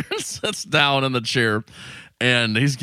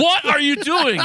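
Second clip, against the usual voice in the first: high mock yell with laughter behind it; hammed-up outraged shout; Tony yelling. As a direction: retell the scolding in a pitched-up squawk. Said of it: "Yells at the guest".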